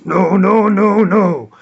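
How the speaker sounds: lightly imitated African-accented refusal; Dikembe Mutombo. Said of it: "he's actually mimicking Dikembe Mutombo".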